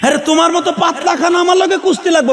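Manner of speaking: high-pitched taunting challenge; acted high, scornful bluster; boastful wrestler's threat. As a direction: threaten the skinny rival in a high voice.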